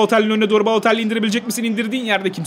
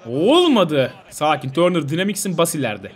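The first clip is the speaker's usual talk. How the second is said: ordinary talk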